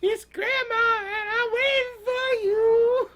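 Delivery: very high falsetto, drawn out slowly; wolf posing as Grandma. Acted. Wolf luring Little Red in as Grandma, high falsetto. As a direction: coo in a fake old-lady falsetto, stretching the ends.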